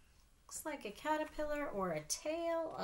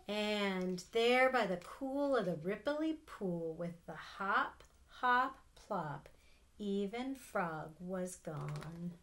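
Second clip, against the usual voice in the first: fading story reading; 3 semitones lower, 3 dB louder, slower than usual. A narrator's reading. Aunt reading the last page, fading gently. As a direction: let the last line drift away. Aunt reads picture book aloud.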